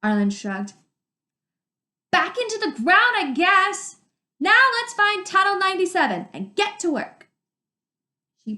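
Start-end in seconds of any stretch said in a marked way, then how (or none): 2.1-7.3 high and loud, calling over water; shouting over the river. Acted. Arlen shouting to Dune by the river.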